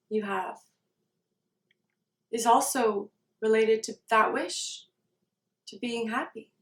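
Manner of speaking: soft meditation voice with long silences; guided meditation. Real, not acted. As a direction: settle into a quiet, spacious recitation of a wish.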